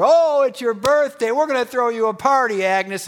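high, loud, teasing cheer; mock-festive birthday cheer; playful teasing. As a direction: tease her like a friend at a party. Girls joking with birthday girl.

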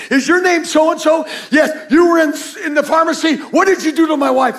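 loud, high, furious shout down the phone; retold shout from a worried husband; angry husband shouting. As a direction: yell it like a frantic husband.

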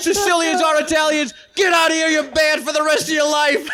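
high, hammed-up tirade; mock furious shout. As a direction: imitate an enraged shop owner throwing someone out. Owner banning man in acted quarrel.

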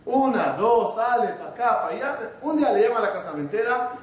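ordinary talk, nothing marked

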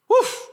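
a sudden high, loud "Woof!"; excited woof. Real, not acted. Preacher hyping up the church with a loud woof. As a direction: bark out "Woof!" like a hype shout.

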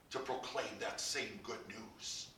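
usual speaking voice

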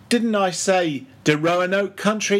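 high, sharp retort; sharp cry. Acted. Old cook retorting to Stubb, sharp high cry. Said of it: "he cried sharply".

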